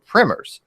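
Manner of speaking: "primers" said as "primmers"; short i in "primers", rhyming with swimmers; short-i pronunciation. Real, not acted. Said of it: "we call them primers".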